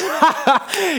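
high mocking cackle of disbelief; a jeering burst of laughter from the mourners; scoffing laugh. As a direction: laugh him off, high and scornful.

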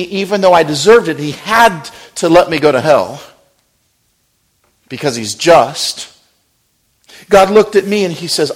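full-force preaching. Acted. Preacher pleading with the church at full force. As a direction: drive it home to the congregation.

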